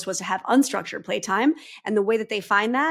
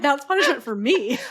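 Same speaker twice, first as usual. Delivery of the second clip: laughing; 5 semitones higher, 4 dB louder than usual. Retold or imitated. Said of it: "You're like".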